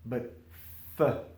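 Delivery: ordinary talk, nothing marked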